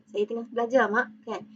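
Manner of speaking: usual speaking voice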